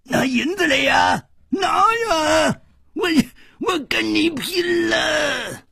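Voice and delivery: put-on very high, wailing old man's yell; frantic old man hollering at top pitch; yammering shout. A narrator's reading. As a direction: shriek it, dragging out the last threat. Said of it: "这个老头子还在穷嚷着".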